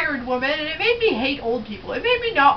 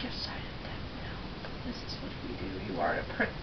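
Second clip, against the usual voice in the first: whispered, sinking very low; Bambi's father speaking gravely to his son; solemn father deer whisper; 17 dB quieter, faster than usual. Retold or imitated. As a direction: intone it like a grave forest prince. Dad deer voiced solemnly.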